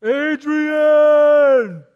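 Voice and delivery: loud, high shouted Adrian!; Rocky's Adrian! yell, hammed up; Rocky impression. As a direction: bellow it like Rocky calling for her. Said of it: "who am I?".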